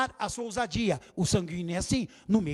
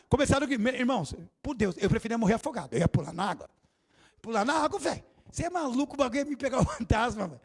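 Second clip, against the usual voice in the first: normal talking voice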